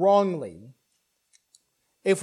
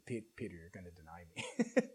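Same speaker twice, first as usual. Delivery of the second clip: much lower, much softer, calm and knowing; quiet certainty, gently correcting Peter; Jesus speaking; 12 semitones lower, 14 dB quieter than usual. Retold or imitated. Jesus quietly telling boastful Peter, low and soft. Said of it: "Jesus is like".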